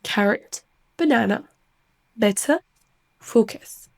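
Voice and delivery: "Carrot, banana, better, focus" with reduced weak vowels; proper English vowels, schwa in the unstressed syllable. Shown as a demonstration. Teacher repeats the example words, English schwa pronunciation. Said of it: "ce son schwa est délicat".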